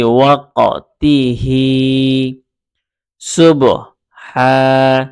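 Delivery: slow, level chant that builds each word; patient Quran-reading drill, vowels held; syllable-by-syllable recitation. Shown as a demonstration. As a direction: say each piece alone, then blend with long final vowels. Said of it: "আমি দুইভাবে পড়ে".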